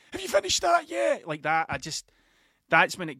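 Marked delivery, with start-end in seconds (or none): 0.1-1.3 put-on impatient kid. Impatient son nagging dad over the Lego build, high and whiny. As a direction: pester like a small kid waiting on Lego. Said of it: "a three-foot thing breathing down".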